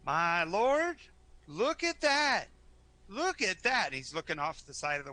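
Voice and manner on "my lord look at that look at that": put-on amazed old man. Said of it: "looks up and goes".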